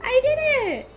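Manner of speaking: very high sing-song cheer; gleeful celebration. Real, not acted. Player proud of the potion, gleeful high cheer.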